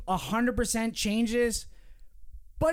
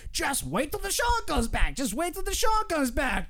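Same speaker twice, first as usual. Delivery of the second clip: higher, eager fan chant; mock hopeful fans; 7 semitones higher than usual. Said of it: "Eagles fans have been like".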